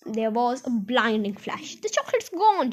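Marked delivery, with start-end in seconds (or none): 1.8-2.7 high-pitched cry of astonishment; amazed shout. Acted. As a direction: exclaim high, without real volume. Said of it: "shouted Grandpa Joe".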